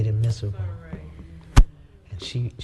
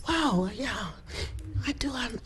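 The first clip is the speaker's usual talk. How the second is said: awed hush; 11 semitones higher, 8 dB quieter than usual. Retold or imitated. Awed realization of peace.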